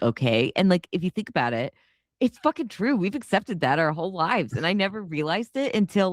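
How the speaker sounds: ordinary talk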